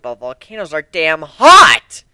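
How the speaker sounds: half-shouted groan about the heat; exasperated outburst. Real, not acted. Griffon grumbling alone about volcano heat, loud exasperated burst. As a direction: blurt it out, fed up with the heat.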